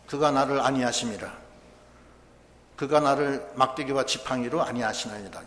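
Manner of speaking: low, measured reading of the psalm; scripture recitation. Acted. Preacher reciting Psalm 23 to the church, solemn and low.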